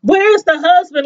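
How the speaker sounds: shocked disbelief. Retold or imitated. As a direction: replay the moment the twist landed.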